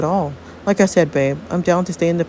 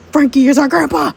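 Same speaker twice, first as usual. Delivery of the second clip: loud, high announcement; raised, astonished exclamation; excited revelation; 7 semitones higher, 5 dB louder than usual. Acted. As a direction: blurt out the shocking news. Shocking family discovery.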